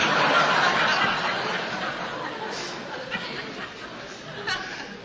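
real squealing laugh at the punchline; high unvoiced giggle; high airy laugh. Real, not acted. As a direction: break into a high breathless laugh.

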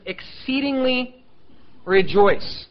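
EXCEEDINGLY high and stretched, REJOICE loud after a pause; emphatic. Shown as a demonstration. Preacher quoting scripture back at the whiners, emphatic. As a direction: split "Exceedingly" and "rejoice" for weight. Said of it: "because it's emphatic".

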